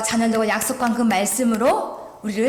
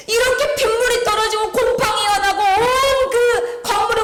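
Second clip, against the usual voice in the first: pleading prayer; 9 semitones higher than usual. Retold or imitated. Pastor retells her pleading prayer.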